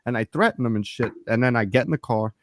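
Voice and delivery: normal talking voice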